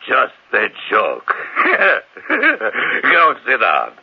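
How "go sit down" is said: laughing. Acted. Laughing off the joke.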